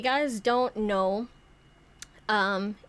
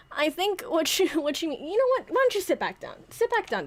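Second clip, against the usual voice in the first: flustered high Santa; 8 semitones higher than usual. Pretend. Flustered Santa hurries puppet.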